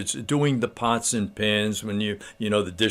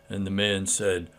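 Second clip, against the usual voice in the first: ordinary delivery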